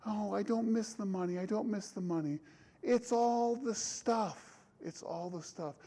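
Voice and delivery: a hint of a weepy wife; a wife in a movie, half-crying to her husband. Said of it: "his wife starts crying".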